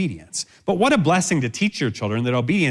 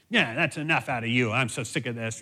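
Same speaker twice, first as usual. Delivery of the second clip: muttered, softer mock exasperation; imitated resentful griping; fed-up parent; 6 dB quieter than usual. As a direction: grumble it like a parent who's had enough. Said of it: "without ongoing emotional punishment".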